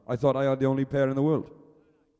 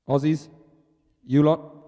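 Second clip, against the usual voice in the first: normal talking voice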